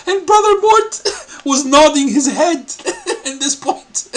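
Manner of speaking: loud, high words breaking into real laughter; cracking up. Real, not acted. Host tells viewers to look at Brother Mort, cracking up. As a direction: laugh so hard the sentences fall apart. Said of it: "that's, it's funny".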